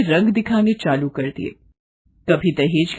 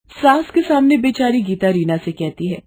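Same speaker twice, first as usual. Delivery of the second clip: ordinary delivery, nothing marked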